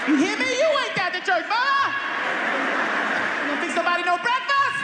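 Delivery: disbelieving mother shrieking at son; shrill-pitched high mama outrage; outraged mama. Retold or imitated. Mama furious.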